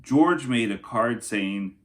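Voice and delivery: storybook narration for kindergartners; lilting rhyming picture-book voice; reading to children. Acted. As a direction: read it like story time for little kids. Reads Night Before book aloud.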